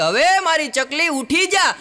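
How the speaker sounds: high, drawn-out call to wake her; slow high shout up the stairs. Retold or imitated. Mother-in-law calls her sleeping daughter-in-law at eleven, high and slow. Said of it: "રાડ પાડી".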